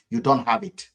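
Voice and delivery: normal talking voice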